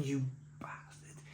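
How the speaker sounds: hushed, breathy whisper through laughter; mock outrage whispered through hysterics; breathless laughing whisper. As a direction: gasp it out, weak from laughing. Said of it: "like, in hysterics".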